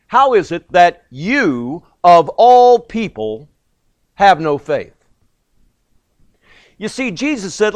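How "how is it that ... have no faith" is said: slow, loud, pressing "of all people"; emphatic rebuke. Retold or imitated. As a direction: lean hard on "you, of all people". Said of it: "in the Greek it's emphatic".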